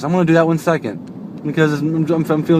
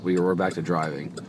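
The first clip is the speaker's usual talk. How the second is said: ordinary talk, nothing marked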